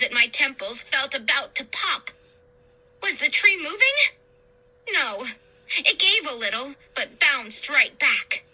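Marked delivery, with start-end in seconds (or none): none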